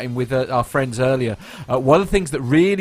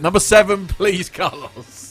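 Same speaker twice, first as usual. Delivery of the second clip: louder, then slow chart host call; countdown host; 4 semitones higher, 3 dB louder than usual. Pretend. Host calling Carlos for number seven, slow showbiz. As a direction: call the next entry like a DJ. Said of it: "gotta have the music".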